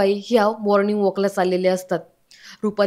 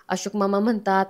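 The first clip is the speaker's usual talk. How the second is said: plain reading voice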